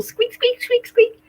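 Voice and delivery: high-pitched little squeaks; squeaky toy imitation. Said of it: "makes a little squeaky noise".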